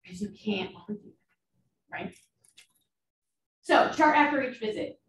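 ordinary talk, nothing marked

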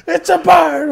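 very high, loud mock holler; hammed-up wild excitement; overexcited shout. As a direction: shriek it like an amazed onlooker. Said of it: "Why are you so excited about the bird?".

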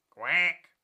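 short quack; curt duck reply; duck answering. Acted.

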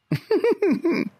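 high-pitched giggle. Real, not acted. Player giggling at the big creature, amused laugh. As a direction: let out a high little laugh.

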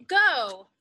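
plain reading voice

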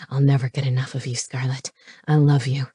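low-pitched declaration of love; low voice, confessing love; devoted male lover. Acted.

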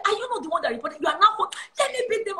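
high-pitched retold scolding from mum; scolding mother. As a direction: imitate an exasperated mother about to punish. Retold mother scolding before a beating.

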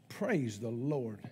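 low, soft and drawn-out; understated praise. Pretend. Preacher reacting to a hundred thousand dollar gift, understated praise. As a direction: say it slowly, deep and hushed. Mock response to big church gift.

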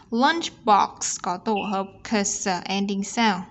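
ordinary delivery

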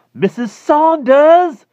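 very high, loud and slow, calling out in excitement; shrill excited shout. Acted. Klausner calling to his neighbor, shrill excited shout. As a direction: yell across the garden, voice cracking high with excitement. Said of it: "his voice was high and shrill with excitement".

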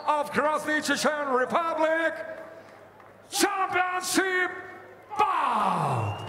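high, building ring announcer shout; arena announcer. Real, not acted. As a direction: pump up the crowd, rising. English hype of title bout.

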